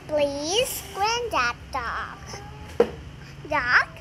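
usual speaking voice

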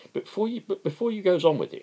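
high, fast, nervous interruption; apprehensive. Acted. Philpot cutting in nervously on Owen's demonstration. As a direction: blurt it out, worried. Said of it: "interrupted Philpot apprehensively".

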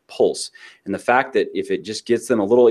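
usual speaking voice

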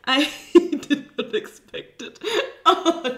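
loud laugh-shout after a scare; startled laughing. Real, not acted. Player hit by a jump scare, loud startled laughter. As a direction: laugh it out in shock.

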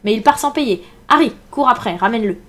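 loud, urgent calling out; proclaimed orders. A narrator's reading. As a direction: send a man running after him. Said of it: "proclama Silver". (ordres lancés à voix forte)

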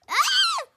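high loud shocked shriek. Acted. Mother shrieking in panic, piercing aa.

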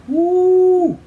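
very high, loud trumpeting woo; long high hoot; elephant hoot. A narrator's reading. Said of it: "raised their trunks and hooted".

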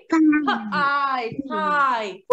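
"P, I" split apart, then blended into "Pie"; sound then blend. Shown as a demonstration. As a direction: model segmenting and blending "Pie". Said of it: "the p sound and the I sound".

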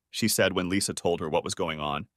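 plain reading voice

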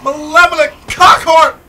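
high, loud furious yell; battle-rage shout at the enemy; angry shout. Real, not acted. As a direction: scream it at the monster. Fighting zombies in game.

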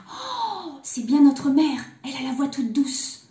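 high gasped oh, then loud delight; excited exclamation. A narrator's reading. Youngest kid believing mother is back, excited cry. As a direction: cry out like a convinced little kid. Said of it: "Le plus petit s'écria".

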